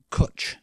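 "cwtch" with a short vowel, as in "put"; Welsh pronunciation. Shown as a demonstration. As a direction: demonstrate "cwtch", rhyming roughly with "butch". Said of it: "now tell us how it really sounds".

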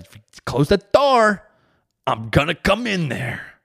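high, loud furious dad threat; stern yell. Retold or imitated. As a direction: shout the threat up the stairs. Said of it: "I said it real stern".

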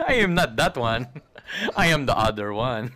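deliberate, comic Tagalog-accented English. Shown as a demonstration. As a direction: insist with a put-on Tagalog accent. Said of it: "do the Tagalog accent really well".

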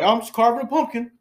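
high, loud imitation of an innocent reply; mock-innocent stepson. Stepson answers his mom, high and loud mock innocence.

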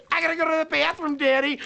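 put-on high, fast child begging from the back seat; hammed-up child voice, pitched high; whiny kid voice. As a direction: kids yelling they need the bathroom. Kids yelling from back seat.

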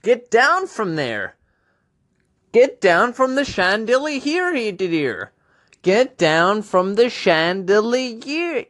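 high-pitched scolding of a pet; a high, exasperated owner calling up; scolding parent. Acted. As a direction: call her down in a high nagging voice. Scolding Sia on chandelier.

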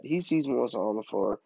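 usual speaking voice

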